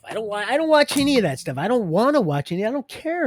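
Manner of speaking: high, loud and fast insistence; heated loud denial. Real, not acted. Speaker insisting to viewers he never watches that stuff, loud. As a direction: push it out high and hard.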